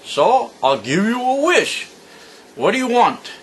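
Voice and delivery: a rude genie fresh out of the bottle; cocky genie. Acted. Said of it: "genie says".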